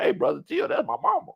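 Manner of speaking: high, bright mock voice; reading Sadie's comment. Host reading Sadie's chat line in a high mock voice. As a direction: read it in a put-on high voice. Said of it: "Sadie talking about".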